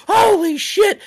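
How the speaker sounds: imitating a fan's high, loud, startled yell; shocked shriek. Front-row fan caught off guard by the superkick, startled shriek. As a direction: shriek it like you just got blindsided. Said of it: "And she goes like".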